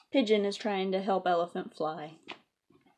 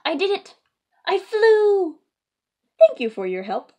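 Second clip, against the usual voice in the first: much higher and louder, triumphant; overjoyed Piggy; 11 semitones higher, 14 dB louder than usual. Acted. Piggy thrilled she flew, higher and louder. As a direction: shout the victory.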